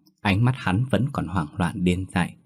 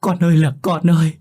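sobbing plea building to a high, loud wail; a mother keening over her broken child, rising to a scream; from a tearful whimper up to a piercing wail; 6 semitones higher, 4 dB louder than usual. A narrator's reading. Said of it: "khóc nấc lên thành tiếng".

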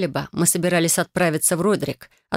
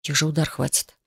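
ordinary delivery

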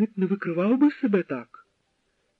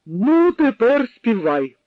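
slow loud order; louder slow German officer's order; commanding; 6 semitones higher, 7 dB louder, slower than usual. A narrator's reading. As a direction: bark out a drunken command. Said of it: "раптом наказав він".

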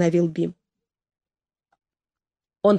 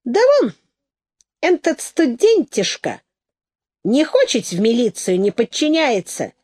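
self-important rustic, pitched up; higher, deliberate voice of a man laying down the rules; dignified explaining; 4 semitones higher, 3 dB louder than usual. A narrator's reading. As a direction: explain with pompous dignity in folksy speech. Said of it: "резонно и с достоинством разъяснил".

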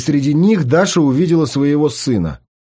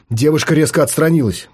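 ordinary delivery

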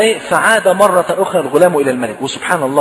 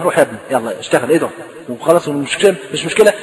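dismissive king; 5 semitones lower than usual. Retold or imitated. King telling the boy to run along, mock dismissive. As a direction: wave the boy away in a lowered voice.

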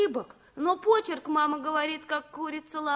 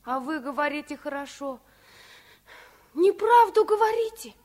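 ordinary delivery

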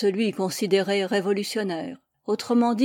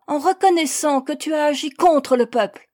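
loud, high denouncing voice; angry accusation; 7 semitones higher, 7 dB louder than usual. A narrator's reading. Persecutor answers the accused woman, angrily denouncing. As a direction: press the charge forcefully.

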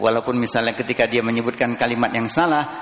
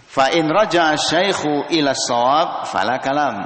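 slow formal reading of the Arabic; formal kitab reading; text recitation; 4 semitones higher, 3 dB louder than usual. Acted. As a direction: read it out like the book.

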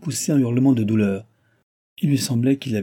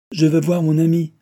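obstinate; 7 semitones higher, 6 dB louder than usual. A narrator's reading. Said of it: "avec obstination".

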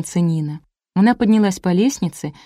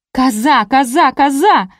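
high, loud drawn-out cries; wailing yell; 6 semitones higher, 4 dB louder, slower than usual. A narrator's reading. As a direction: holler it slowly in alarm, over and over. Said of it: "завопила снизу Марина". (протяжный вопль)

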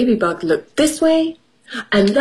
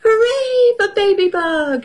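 hammed-up high-pitched cheer; cheering cry; 13 semitones higher than usual. A narrator's reading. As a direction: cheer like a crowd of little storybook animals. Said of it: "everyone cried".

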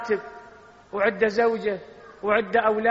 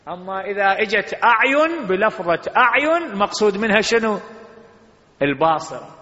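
louder, rhetorical question to the crowd; preaching; 3 semitones higher, 7 dB louder than usual. Acted. Preacher quizzing the congregation, raised voice. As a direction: call out the question and answer it.

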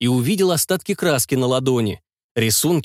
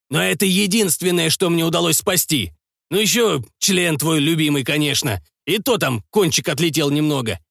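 stern lecture; 6 semitones higher than usual. Acted. Doc lectures about injuries.

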